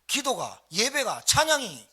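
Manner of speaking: rallying preacher's crescendo; building exhortation. Real, not acted. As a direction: build step by step to a forceful peak. Building list leading into a blessing.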